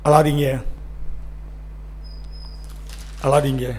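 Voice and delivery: low, hushed reassurance with long pauses; tender consoling. Real, not acted. Preacher tells the weeping congregation not to cry, low and tender. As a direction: comfort them gently, voice dropped low.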